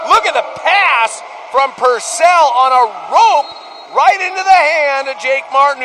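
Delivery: excited goal call. Real, not acted. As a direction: let the voice soar as the pass lands. Goal call after a great pass.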